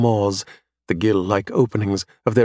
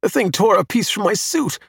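high, indignant outcry; angry scream; 9 semitones higher than usual. A narrator's reading. Adam whose suit was torn, screaming angrily. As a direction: complain in a high, angry cry. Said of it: "Adam screams angrily".

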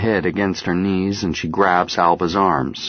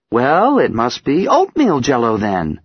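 teasing; 6 semitones higher, 5 dB louder than usual. Acted. Mother teasing.

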